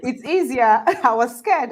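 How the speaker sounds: talking through a laugh; laughing relief. Real, not acted. Host relieved the Japanese reply is easy, laughing. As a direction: let laughter break into the words.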